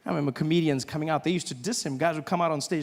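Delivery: ordinary talk, nothing marked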